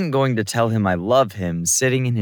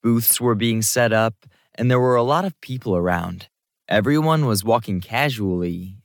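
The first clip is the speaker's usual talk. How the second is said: usual speaking voice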